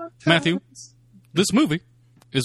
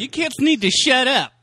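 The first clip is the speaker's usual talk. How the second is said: angry librarian; 12 semitones higher, 6 dB louder than usual. Acted. Kids shushed in library skit.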